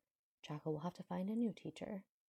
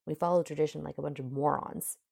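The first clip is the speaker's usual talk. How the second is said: sudden loud snap of irritation; snapped; 9 dB louder than usual. A narrator's reading. Prince cursing his own people's tradition, snapping. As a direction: snap it out, hitting the words hard. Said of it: "he snaps".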